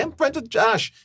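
nervous, high-pitched blurt to a star; nervous squeak. Retold or imitated. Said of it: "I'm, like, real nervous".

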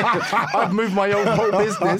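high, loud laughing. Real, not acted. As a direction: crack up while telling. Laughing retelling of excuse.

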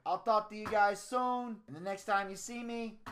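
lightly voiced mobster Don; Tony D. The Don signing off to listeners, boastful. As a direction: play a boastful Italian-American Don.

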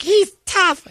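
very high, put-on doting wife voice; proud wife impression. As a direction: gush about him like a proud wife. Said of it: "she's like".

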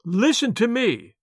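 slow, loud call. A narrator's reading. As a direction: call it out slowly and loudly. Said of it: "he called as loudly as he could".

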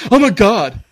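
high, loud mock dismay; exaggerated horrified outburst; mock panic. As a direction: imitate an appalled young guy. Imitates panicked young co-workers.

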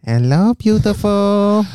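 put-on slow, drawn-out Chinese accent; mock Chinese accent, slow and smooth. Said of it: "there's an accent you could do".

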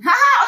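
loud, high laugh bursting out; burst of laughter. Real, not acted. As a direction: crack up at your own joke. Laughing at hashtag name joke.

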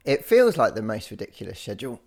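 normal talking voice

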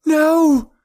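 very high, loud scream. A narrator's reading. Elora hears the gunshot, high scream. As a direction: scream it out at full pitch. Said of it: "Elora screamed".

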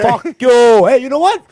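loud hammed-up Italian tirade; mock angry yell. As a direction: yell it like a mobster. Mock Italian tough guy yelling.

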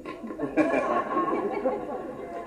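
soft unvoiced chuckle; a quiet airy laugh; breathy laugh. Real, not acted. Preacher laughs at his slip.

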